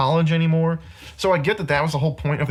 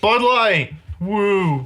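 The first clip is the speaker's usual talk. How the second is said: higher, hammed-up advert cheer; mock product plug; 5 semitones higher, much slower than usual. Podcaster mocking blatant product placement, hammed-up plug. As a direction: show off the beer like a shameless plug. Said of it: "holding it in front of the camera".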